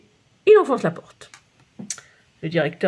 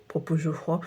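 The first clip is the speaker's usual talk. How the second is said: usual speaking voice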